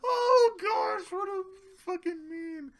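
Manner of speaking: high squeaky voice after laughing; tickled, high-pitched sigh of words; amused recovery. Real, not acted. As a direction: wind down from a laughing fit.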